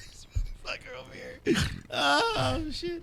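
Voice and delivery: hushed whisper breaking into laughter. Real, not acted. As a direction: whisper it as an aside, giggling. Aside to the audience about hiccups.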